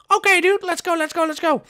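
very high squeaky character voice; eager little recruit. Acted. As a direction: squeak it out, raring to go. Toy recruit eager to go hunting.

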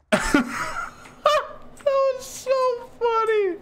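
high, slow words through laughter; real giggling fit; laughing. Real, not acted. Laughing at enemy reaction.

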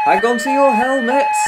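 high, very loud call; shouted warning. A narrator's reading. Fireman as the engine roars downhill, shouted warning. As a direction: shout over the engine and siren. Driver calls out over siren.

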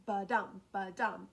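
"ba-DUM, ba-DUM", second syllable punched; unstressed "ba", loud stressed "dum"; iambic stress. Shown as a demonstration. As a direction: beat out "ba-DUM" with the weight on DUM. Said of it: "one unstressed and one stressed".

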